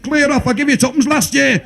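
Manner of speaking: high, shouted brush-off; mean old man's shout. Retold or imitated. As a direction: yell it down at them, miserly. Stingy father shouts down at rescuer.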